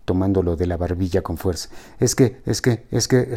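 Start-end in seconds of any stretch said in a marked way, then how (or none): 2.0-3.3 a hint of a small child's voice, repeating; childlike stammer. Ricardo stalling before his girlfriend, lightly childlike. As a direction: stall like a tongue-tied little boy. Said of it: "con voz de niño dislálico".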